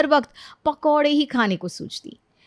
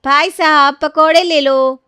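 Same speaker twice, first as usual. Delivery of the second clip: loud, high, slow vendor's call; sleepy hawker call; 6 semitones higher, 10 dB louder, slower than usual. Acted. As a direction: sell pakoras to a passerby. Half-asleep selling pakoras. (नींद में ऊँची, धीमी आवाज़ में पकौड़े बेचती)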